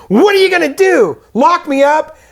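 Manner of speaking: a hammed-up indignant Ben; Ben ranting. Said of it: "He's like".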